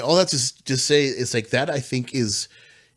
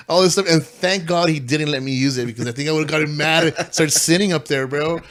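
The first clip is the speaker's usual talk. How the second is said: ordinary talk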